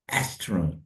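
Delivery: normal talking voice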